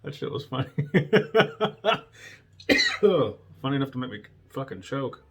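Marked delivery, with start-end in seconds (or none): 0.7-2.0 high, loud laugh breaking out; helpless real laughing; real burst of laughter. Real, not acted. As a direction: crack up at the memory. Laughing at own story.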